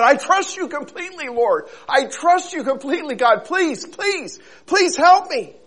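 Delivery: high, loud desperate assurance; pleading. Acted. Man begging God, swearing trust. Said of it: "The man said".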